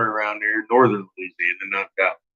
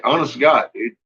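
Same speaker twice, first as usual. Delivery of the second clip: ordinary talk, nothing marked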